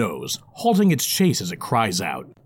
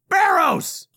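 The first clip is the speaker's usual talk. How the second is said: calling out. Acted. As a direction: shout toward the kitchen. Dwarf calling out.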